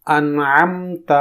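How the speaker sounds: انعمت slowly with a clear ع; teaching انعمت; slow careful انعمت. Shown as a demonstration. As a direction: stretch انعمت. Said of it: "اسے سنیے".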